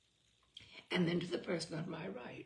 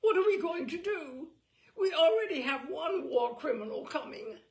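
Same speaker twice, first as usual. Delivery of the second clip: high, loud, near-crying panic; mock panic of a frantic hostess; panicked hostess; 8 semitones higher, 9 dB louder than usual. A narrator's reading. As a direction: wail it in society-lady horror. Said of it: "she says to Oscar".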